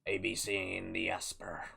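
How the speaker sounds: lightly voiced pirate talk; pirate captain. Pirate streamer narrating his boat build, lightly voiced pirate. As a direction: play a grumbling-along pirate builder.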